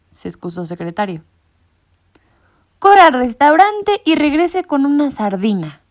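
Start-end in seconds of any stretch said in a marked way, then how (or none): 2.8-5.8 louder, higher barked command; ordering. A narrator's reading. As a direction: send the secretary running. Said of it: "ordenó Colonello". (Colonello da una orden en voz alta)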